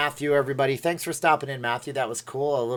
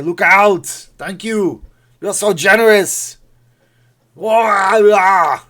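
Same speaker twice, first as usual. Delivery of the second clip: loud, raised Austrian action-star yell; Arnold; 7 semitones higher, 12 dB louder, much slower than usual. Acted. Streamer showing off for viewers, yelled Arnold impression. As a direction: bellow it like a hammed-up action hero. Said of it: "I can do Arnold".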